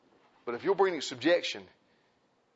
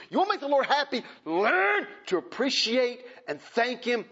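high, slow preacher's build with rising pitch; preaching at full force; 8 semitones higher, slower than usual. Acted. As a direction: preach it out, stretching the words.

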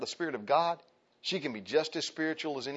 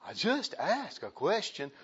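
a hint of a hurt wife; wife's raised protest that she only asked a question; mock wife protest; 6 semitones higher, slower than usual. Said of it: "And you're saying".

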